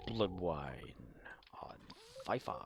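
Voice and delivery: whispering the words back; breathy whispered repeat; hushed copy of a line; whispered echo. Pretend. Echoing a game line.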